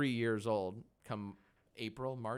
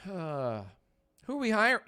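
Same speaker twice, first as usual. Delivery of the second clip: slow, high, sighing and strained; weary sigh through the words, slow; sighing drag; 8 semitones higher, 7 dB louder, much slower than usual. Real, not acted. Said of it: "it sounds like I ate dairy".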